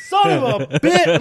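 slow, high cursing through laughter; mock freaking out. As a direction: imitate a startled man's drawn-out swearing. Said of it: "he'd just be freaking out".

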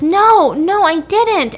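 high, loud protest; indignant denial. Retold or imitated. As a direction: act out a shocked denial. Retold denial of an accusation.

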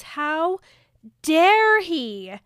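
loud, high, indignant cry; acted furious outburst. Co-host voices Bella mad at Edward, outraged shout. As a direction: hit both halves hard, outraged. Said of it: "And then she's so mad".

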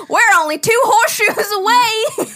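high, loud country holler; country accent. Retold or imitated. Retelling their silly road-trip bit, loud country accent. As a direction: whoop it in a hammed-up country twang. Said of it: "busting out a country accent".

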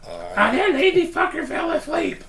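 high, loud mock holler; shrieky put-on complaint, yelled out; Stacy yelling. Imagined complaint about a host keeled over, high mock yelling. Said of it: "I could hear Stacy yelling out".

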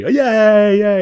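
loud, high, overdone cheer; mock cheering. Imitating a friend's belated reaction to the news, loud mock cheering. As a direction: whoop a hammed-up imitation of celebrating.

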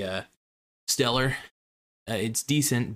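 normal talking voice

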